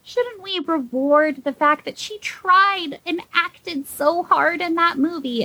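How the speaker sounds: dragged-out sarcastic question; sarcastic plea. Pretend. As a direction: plead it with fake sincerity. Sarcastic case for Glenn Close.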